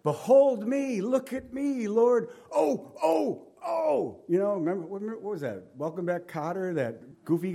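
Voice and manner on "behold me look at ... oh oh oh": put-on much higher, loud and eager, like a kid begging to be picked; high, eager cry of Behold me, look at me; eager pleading. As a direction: beg to be noticed, voice up high. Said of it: "indicates that he said".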